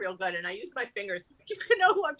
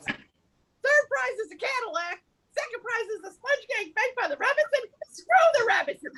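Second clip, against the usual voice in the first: indignant protest; 10 semitones higher than usual. Acted. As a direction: protest like a cheated raffle winner. Cheated winner protests the prize.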